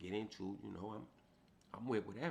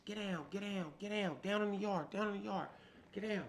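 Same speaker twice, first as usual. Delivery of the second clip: guards shouting; 11 semitones higher, 4 dB louder than usual. Retold or imitated.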